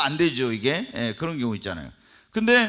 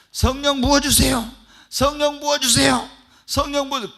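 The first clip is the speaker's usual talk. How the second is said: louder and higher, crying out each plea; desperate loud prayer; 10 semitones higher, 8 dB louder than usual. Shown as a demonstration. Pastor showing persistent prayer for the Spirit, desperate cry. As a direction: pour everything into the plea, calling out harder each time. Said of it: "밤새내 성령을 모달로 기도해야지".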